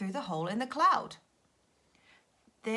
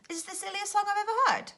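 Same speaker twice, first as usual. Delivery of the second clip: high and loud, delighted; thrilled by the silly song; excited; 11 semitones higher, 6 dB louder than usual. Acted.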